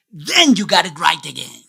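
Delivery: ordinary talk, nothing marked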